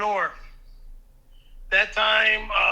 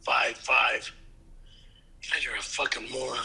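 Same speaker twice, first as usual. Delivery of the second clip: scornful mutter; 13 semitones lower, 5 dB quieter than usual. Pretend. Scornful mutter about description.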